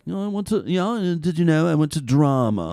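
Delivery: drawn-out British "drama" student; mock British accent. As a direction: imitate a self-serious British thespian. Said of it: "you're mimicking the British".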